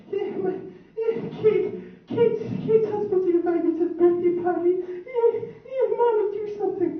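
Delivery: mock sobbing tattletale to her mom; whiny crying sister. Sister whining and fake-crying to her mommy, high and whimpering. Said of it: "calling her mommy, complaining".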